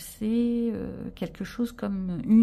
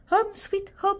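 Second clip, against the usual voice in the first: sing-song English; 12 semitones higher, slower than usual. Pretend. Reads sticker in English, playful.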